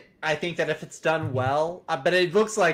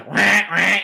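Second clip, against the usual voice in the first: mock duck quacking; two honking quacks in jest; 6 dB louder than usual. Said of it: "You sound like a duck".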